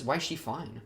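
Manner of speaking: ordinary talk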